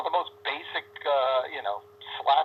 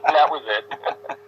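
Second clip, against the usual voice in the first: laughing; 3 semitones higher, 7 dB louder, much slower than usual. Real, not acted. Laughing while telling.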